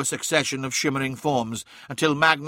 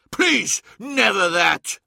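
high, loud plea; desperate cry; 7 semitones higher, 5 dB louder, slower than usual. A narrator's reading. Magnus pleads for his legion, crying out desperately. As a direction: beg at the top of the voice. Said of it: "cried Magnus".

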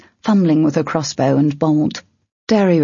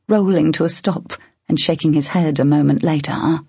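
ordinary delivery

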